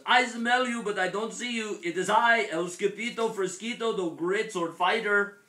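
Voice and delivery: high, loud grand announcement of a little hero; calling out. A narrator's reading. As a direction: proclaim yourself loudly to hidden friends. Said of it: "called out Skippy John Jones".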